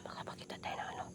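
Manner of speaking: fast hushed whisper; whispering while hiding. Real, not acted. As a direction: whisper so nobody hears. Hiding from someone in game.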